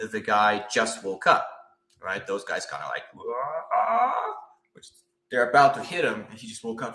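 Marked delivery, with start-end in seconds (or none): 3.1-4.3 short, higher yelled wah; a goblin's mock battle yell; mock war cry. Goblins about to attack.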